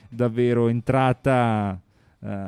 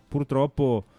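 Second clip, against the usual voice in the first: usual speaking voice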